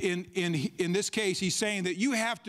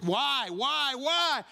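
building why; 6 semitones higher, much slower than usual. Acted. Preacher presses a rhetorical question.

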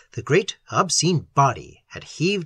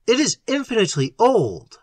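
raised, higher-pitched cry; 7 semitones higher than usual. A narrator's reading. Little to the others in the car about the creature, crying out. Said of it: "cried Little".

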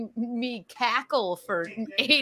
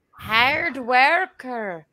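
slow, drawn-out Irish brogue; mock Irish accent; much slower than usual. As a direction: stretch it out in put-on Irish. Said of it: "Irish accent".

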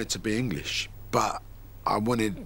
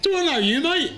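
higher and louder mock snub; mock rude brush-off; 6 dB louder than usual. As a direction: toss it off like a mock put-down. Retells his own rude brush-off.

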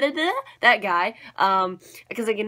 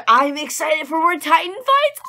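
bright, eager announcement; hyped fan saying it with energy; louder, higher excited cheer; 6 semitones higher, 5 dB louder than usual. Real, not acted. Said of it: "I'm so excited".

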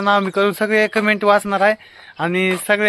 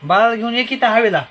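raised, higher voice calling across; 5 semitones higher, 5 dB louder than usual. Real, not acted. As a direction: call to someone to come out. Calling her to bring baby. (मोठ्याने बोलावत)

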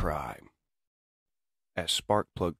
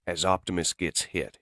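plain reading voice

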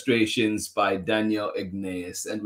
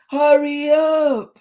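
very high, loud, stretched call; hurrying teacher; 10 dB louder, slower than usual. A narrator's reading. Teacher interrupting Tisha's drawing, high drawn-out call. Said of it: "said the teacher".

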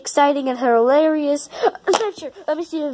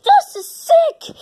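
high, slow, excited exclamation; wildly impressed; 10 semitones higher, 3 dB louder than usual. Acted. Character admiring the new book, wildly impressed. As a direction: stretch it out in awe.

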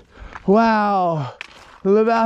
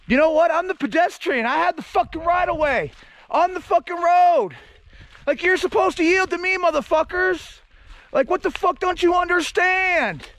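raised-pitch angry rant at passing drivers; fed-up rant; 6 semitones higher than usual. Real, not acted. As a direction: pedestrian yelling at reckless drivers from roadside, fed up. Ranting at speeding drivers.

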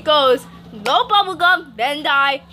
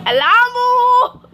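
loud slow high yell; shouted; 8 semitones higher, 6 dB louder, much slower than usual. Real, not acted. Child blurting a loud slow yell. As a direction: belt it out slowly. Kids joking over story.

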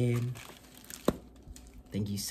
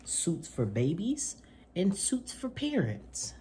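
bright, lively picture-book reading voice; reading to children; 6 semitones higher, slower than usual. Acted. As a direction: read it to little kids with gusto. Reads picture book aloud.

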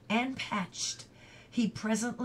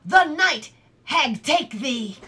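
slow, loud, raised-pitch shout; shouted curse; 5 semitones higher, 11 dB louder than usual. A narrator's reading. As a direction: hurl it as an angry curse. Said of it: "shouted the other".

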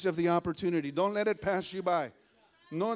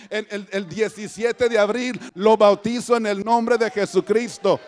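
loud, ringing pulpit proclamation; preach; 11 dB louder than usual. Real, not acted. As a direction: proclaim it at full force. (proclamación a toda voz)

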